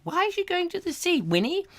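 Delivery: put-on very high shocked old lady; gossiping old lady. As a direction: squeal it, high and prim. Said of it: "I've got to get out of character".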